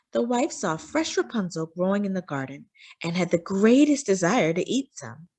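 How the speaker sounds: plain reading voice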